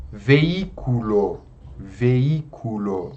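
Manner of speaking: veículo slowly, stretching the stressed "í"; antepenultimate stress. Shown as a demonstration. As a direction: draw out veículo, leaning on its second syllable. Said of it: "cai na antepenúltima sílaba". (veículo devagar, com o "í" forte)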